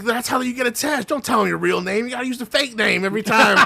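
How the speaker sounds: high, urgent mock warning; a hint of a panicked friend; imitating the buddy. Retelling a buddy's warning to a ghost hunter, high mock panic. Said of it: "He's like".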